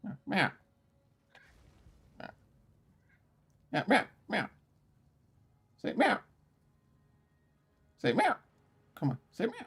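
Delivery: high coaxing meow voice for a pet. Real, not acted. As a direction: squeak out meows to coax the cat.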